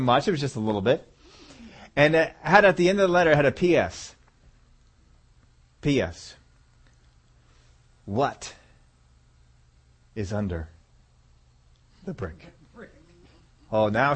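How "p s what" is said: normal talking voice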